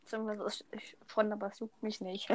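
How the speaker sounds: breathy whispered shrug; hushed dismissive aside. Pretend. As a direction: whisper it like you truly don't care. Whispered indifference.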